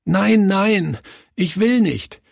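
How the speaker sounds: put-on high, wailing child's refusal; acted sobbing of a small boy; sobbing protest. A narrator's reading. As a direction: plead and protest in a tearful high voice. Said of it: "schluchzte er".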